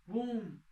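sharp high "pum" like a car hitting a wall; a quick mock bang; imitated crash. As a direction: punch out the crash noise. Crash sound in shooting story.

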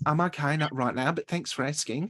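polite mock-Australian refusal; copied Australian accent. Passenger turning down the steward's offer, imitated Australian accent. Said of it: "I'd done it unconsciously".

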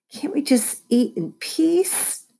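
high, slow exasperated plea; pleading. A narrator's reading. Mom begging her kids to stop fighting, pleading.

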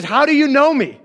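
high, loud challenging question; a little skeptical. Retold or imitated. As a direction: challenge him with mild suspicion. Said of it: "he's still a little bit skeptical".

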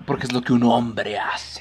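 low, slow, breathy-free whisper of manly wisdom; mock whisper. Joking to his friend, a mock manly whisper. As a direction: whisper it like a solemn joke.

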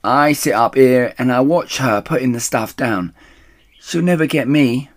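loud, high smug boast; boastful. Acted. Rat boasts to Mister Fox, smug and loud. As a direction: brag from your shelf.

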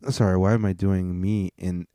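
ordinary talk, nothing marked

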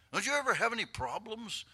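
loud, high-pitched disbelieving question; incredulous questioning. Acted. The world questioning an always-happy believer, incredulous.

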